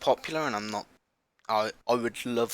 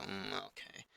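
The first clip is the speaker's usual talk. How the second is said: low-key, much softer mumbled reply; unenthusiastic quiet; 3 semitones lower, 15 dB quieter than usual. Acted. Host imagining himself playing a game he dislikes, unenthusiastic and quiet. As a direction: act out a half-hearted, quiet response. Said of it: "and not talking at all".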